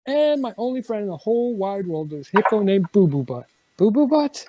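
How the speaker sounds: silly read-aloud, squeaky on Boo Boo Butt; reading to children. Acted. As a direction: play up the silly name. Reads silly lines aloud to a child.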